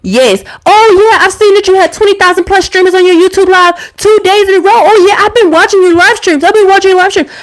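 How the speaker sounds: high, mock-eager imitation of her ex; sarcastic impression of a clingy ex bragging he watched; mocking ex's voice. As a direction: parrot him in a high, fawning voice. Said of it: "he was like".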